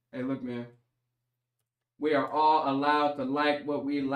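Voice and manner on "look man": usual speaking voice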